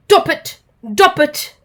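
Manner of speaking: elephant noise imitation. Dizzy asks the elephant a riddle, with elephant noise. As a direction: trumpet it like an elephant. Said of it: "large and grey and goes".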